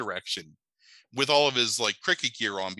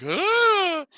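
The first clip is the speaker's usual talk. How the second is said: high, loud shriek; mock scream; 7 dB louder than usual. Woman in the movie screams at the snakes, high loud shriek. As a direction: let out a short startled shriek.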